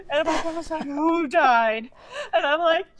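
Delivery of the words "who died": loud, raised alarmed question; imitating a startled grandmother; shocked grandma. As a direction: demand it in mock alarm.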